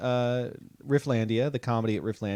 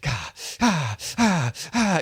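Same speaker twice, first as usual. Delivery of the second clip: mock panicked gasps of a terrified driver; imitating frightened drivers on the freeway; scared cries; 3 semitones higher, slower than usual.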